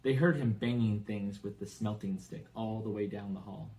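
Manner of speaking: usual speaking voice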